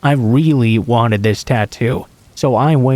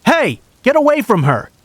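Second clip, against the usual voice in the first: narrated yell, pitched way up; yelled warning; 11 semitones higher than usual. A narrator's reading. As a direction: call out sharply to scare someone off. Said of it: "I heard someone yell out".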